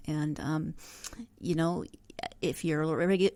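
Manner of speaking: usual speaking voice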